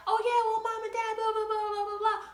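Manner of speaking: high, sarcastic back-talk; mock the back-talk; mocking kid. Kid talking back to parents, high and mocking. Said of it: "they're like".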